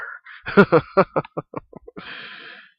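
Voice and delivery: airy laugh. Real, not acted. Laughing after his own impression, airy laugh.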